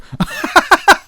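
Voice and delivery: squealing high laugh; high burst of laughter. Real, not acted. Laughing at the lady blaming her friend, squealing.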